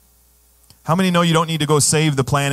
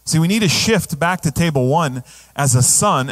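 normal talking voice

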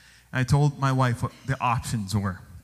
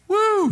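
high, loud excited whoop; joyful whoop; 5 dB louder than usual. Real, not acted. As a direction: let out a celebratory woo.

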